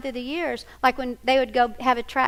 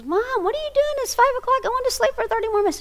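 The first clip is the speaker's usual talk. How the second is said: high-pitched mock teenage whine; whiny daughter; 14 semitones higher, faster than usual. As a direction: protest at being woken, pitched way up. Groggy daughter protests early wake-up.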